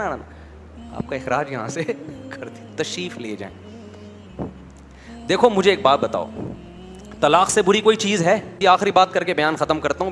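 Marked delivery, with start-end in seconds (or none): 0.0-3.7 hushed, much softer courteous send-off; the gentle version against the rude one; quiet respectful farewell; soft polite dismissal. Retold or imitated. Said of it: "ان کا style change نہیں ہوگا".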